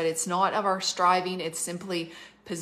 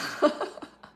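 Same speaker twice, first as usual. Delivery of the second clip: laugh; 8 semitones higher than usual. Real, not acted. Laughs at own joke.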